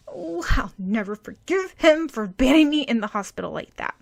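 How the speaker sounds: ordinary delivery, nothing marked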